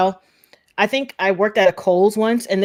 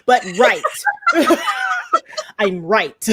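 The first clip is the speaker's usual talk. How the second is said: laughing; 7 semitones higher, slower than usual. Real, not acted. Laughing at own rhyme.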